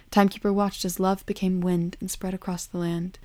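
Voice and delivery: ordinary delivery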